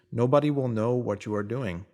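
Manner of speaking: ordinary talk, nothing marked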